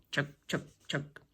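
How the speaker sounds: low soft chug chug chug; low rumbling tractor chug; soft low engine chugging. Acted. Tractor sound effect.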